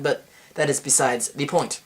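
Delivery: normal talking voice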